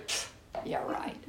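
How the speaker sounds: scoffing "Pfft, yeah, right" under the breath; sarcastic whisper. Retold or imitated. Doubting the lady's excuse about lost texts, sarcastic whisper. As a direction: whisper the disbelief with a dismissive puff. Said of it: "like you're blowing smoke".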